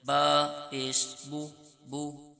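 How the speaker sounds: بو sung slowly with rounded lips; rounded pesh sound. Shown as a demonstration. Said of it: "ہونٹوں کو گول کر کے". (بو کو ہونٹ گول کر کے آہستہ پڑھیں)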